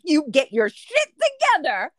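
loud, forceful mock telling-off; stern scolding. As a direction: shake some sense into a tipsy friend. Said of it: "I will grab you and be like".

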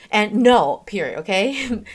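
no said loud and flat with a falling close; a firm, falling no that ends the talk; firm final no. Shown as a demonstration. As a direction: put a full stop on no, never a question. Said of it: "the end of no is not a question mark".

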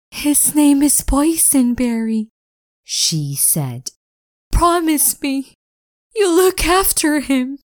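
tearful plea. Acted. Tearful farewell, with tears.

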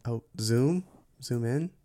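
normal talking voice

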